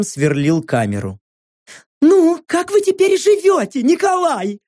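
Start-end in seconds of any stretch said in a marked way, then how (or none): 2.0-4.6 very high, sing-song chirp; twittering TV hostess. Acted. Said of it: "прощебетала Клаудия".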